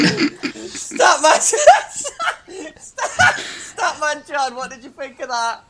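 high words squeezed through laughter; laughing. Real, not acted. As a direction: barely get it out laughing. Still laughing hard.

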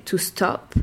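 British stop; "stop" with a round O; British English. Shown as a demonstration. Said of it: "En anglais". (en anglais britannique, "stop" avec un O rond)

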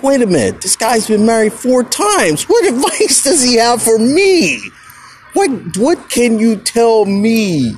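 high, mocking disbelief at the radio; incredulous rant. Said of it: "And I'm like".

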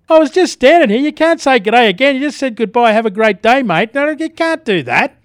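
hammed-up hurt protest at the greeter; mock indignant. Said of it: "Oh, really disappointed".

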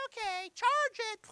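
high, stretched, loud mock-spouse voice; exaggerated breezy squeal of agreement; carefree spender. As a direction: sing out the reply, drawn out and high. Acts out spouse told to cut spending.

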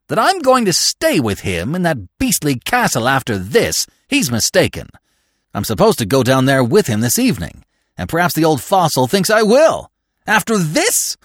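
outrage climbing high and incredulous; indignant tirade. A narrator's reading. McTodd refusing to stay at the castle, indignant. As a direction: protest hotly, peaking on the question.

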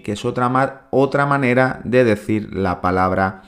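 ordinary talk

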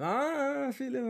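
high-pitched drawn-out "Ah", imitating the bully; put-on high voice for a taunting greeting; mock teasing call. Retold bully greeting him, teasing.

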